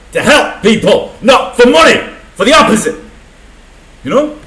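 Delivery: real outrage, loud and high; angry rant. Real, not acted. Ranting about doctors and nurses.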